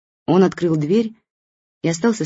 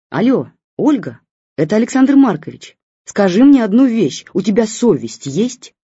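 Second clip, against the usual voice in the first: put-on loud, higher, indignant old man on the phone; grumpy old man; 6 semitones higher, 7 dB louder than usual. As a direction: scold her down the line like a hurt old friend.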